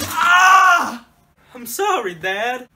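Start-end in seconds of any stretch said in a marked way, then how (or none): 0.1-1.1 loud high furious scream; rage scream. Acted. Son screaming at dad, hammed-up rage yell. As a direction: let out a raging yell.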